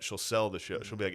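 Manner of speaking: ordinary talk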